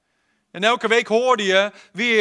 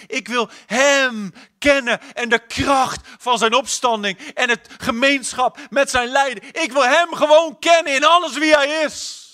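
rising higher and louder to a cry; Paul crying out to know him, building to loud; building fervor; 6 semitones higher, 5 dB louder than usual. Acted. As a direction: build from longing to a full-voiced cry. Paul's longing, building.

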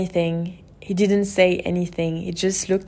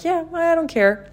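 lightly voiced boyfriend, higher and easygoing; carefree boyfriend; 10 semitones higher than usual. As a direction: toss it off like he truly doesn't mind. Boyfriend voiced in retold talk.

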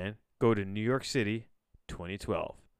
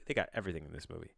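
usual speaking voice

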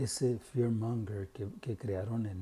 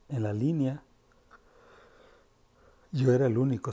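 más fuerte; 3 semitones higher, 8 dB louder than usual. Real, not acted. Proud of being unmasked in line.

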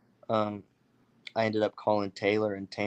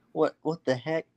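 surprised; 7 semitones higher than usual. Retold or imitated. Friend shocked at the deer.

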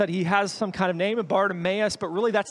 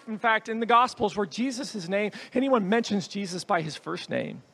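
normal talking voice

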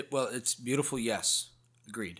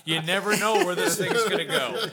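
loud laughing joke; 5 semitones higher, 8 dB louder than usual. Real, not acted. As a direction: shout it through laughter.

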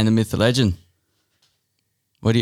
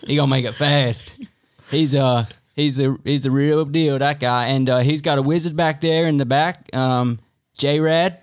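a hint of a US accent, playful; mock American accent. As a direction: hype man in a put-on American voice. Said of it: "we're going American".

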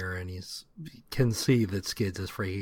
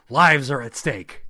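heroic declaration; 4 semitones higher, 9 dB louder than usual. Retold or imitated. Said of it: "he's like".

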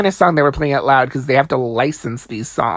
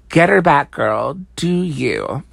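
ordinary delivery

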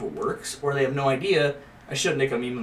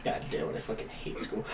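whispered gripe; 13 dB quieter than usual. Real, not acted. Mock frustration, whispered laughing.

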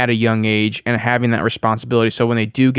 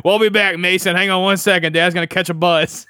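mock careless dad; 7 semitones higher than usual. Joking dad leaving kids alone.

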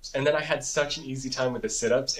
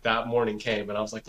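ordinary delivery

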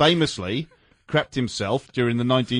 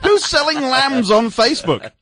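incredulous; 12 semitones higher, 7 dB louder than usual. Real, not acted. Incredulous aside during news read.

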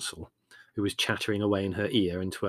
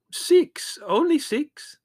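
high, startled reply; surprised; 6 dB louder, much slower than usual. A narrator's reading. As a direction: caught off guard, much higher. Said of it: "said the doctor in surprise".

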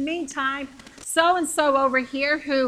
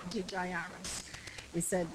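normal talking voice

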